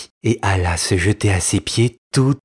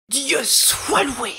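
very high, slow cry of thanks; relieved exclamation; much slower than usual. Acted. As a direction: cry out your thanks to heaven. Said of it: "s'écria-t-elle un moment après".

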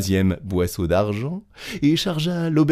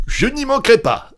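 very high, loud promise; eager assent; 5 dB louder than usual. A narrator's reading. Innkeeper promising Petit Claus to serve her, hearty. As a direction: agree heartily, pitched way up.

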